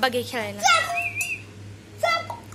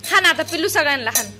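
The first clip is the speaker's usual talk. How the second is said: ordinary delivery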